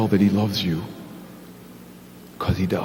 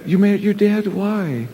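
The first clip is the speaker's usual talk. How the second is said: put-on higher, sing-song voice for a child; puppet chat; 6 semitones higher than usual. Pastor coaxing the puppet Big Al, higher and sing-song. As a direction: coax a sulky little kid to talk.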